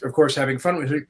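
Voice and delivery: normal talking voice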